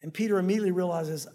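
usual speaking voice

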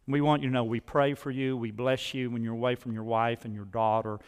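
ordinary delivery

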